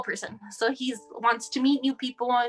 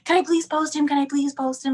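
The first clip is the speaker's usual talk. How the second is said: begging sister; 5 semitones higher, 4 dB louder than usual. Retold or imitated. Teen sister begging to post.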